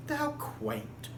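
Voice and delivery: a prissy little "How quaint."; mock prim. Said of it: "you're like".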